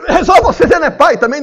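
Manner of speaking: laughing retort. Acted. Mother retorts to father, laughing.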